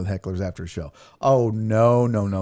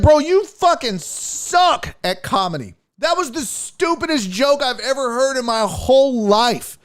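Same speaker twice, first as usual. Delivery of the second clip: high, loud furious tirade; pitched-up ranting insult barrage; angry rant; 12 semitones higher, 3 dB louder than usual. Retold or imitated. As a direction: tear into the heckler. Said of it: "I was like".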